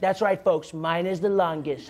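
slow boastful politician impression; mock presidential brag, stretching the last words; Trump. Imagined president bragging to folks about the shutdown, boastful impression. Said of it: "Trump is secretly going for. He's like".

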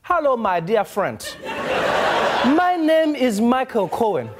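put-on high, declaiming African-accented scammer voice; hammed-up African accent, grandly sincere. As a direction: pitch the scam in a broad African accent. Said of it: "pitched them in an African accent".

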